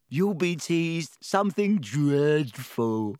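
slow, delighted ghost voice; gleeful ghost. Acted. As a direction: gloat over it slowly. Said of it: "said Myrtle happily".

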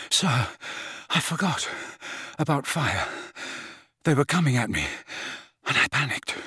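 out of breath, broken into short gasped phrases; panting. A narrator's reading. Panicked boy confesses to his headmaster, panting. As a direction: pant it out softly between long pauses. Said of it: "panted Harry".

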